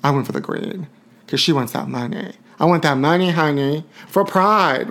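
ordinary talk

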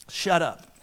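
voice of the Lord. Retold or imitated. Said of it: "what the Lord said to me".

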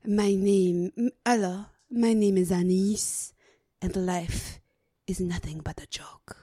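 put-on depressed French accent. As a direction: sigh it like a bored French vampire. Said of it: "depressed French vampire".

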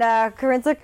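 usual speaking voice

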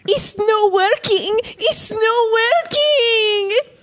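high slow wailing cry. Retold or imitated. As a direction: wail it like a dying man. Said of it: "phone call test of the quote".

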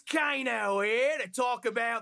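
put-on hammy Aussie villain intro in a thick Australian accent, slowing down at the end; Kano Australian accent. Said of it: "nine out of ten Australian accent".

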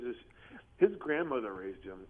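normal talking voice

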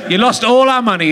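ordinary delivery

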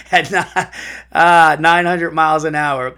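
loud, amused, laughing through the words; can't keep a straight voice; real laughing. Real, not acted. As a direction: crack up while trying to read it out. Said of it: "I'm laughing 'cause it's just funny".

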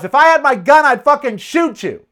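loud, high furious threat; angry yell. Retold or imitated. Man yelling at a cyclist who mocked him, furious yell. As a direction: yell it in a rage. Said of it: "I yelled out something".

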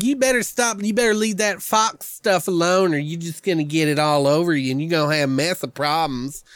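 high Southern warning drawl; mock Georgia drawl. The clerk warning a customer off fox stuff, high Georgia drawl. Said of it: "he's like".

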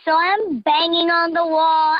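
ordinary delivery

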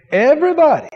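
everybody, pitched high and pushed out; emphatic call out. Real, not acted. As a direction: drive it home to the whole church.

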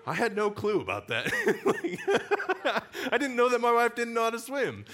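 high, loud laughing disbelief; real laughter breaking into the words; laughing hard. Real, not acted. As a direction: laugh through it, can't believe it. Laughing about wife not swimming.

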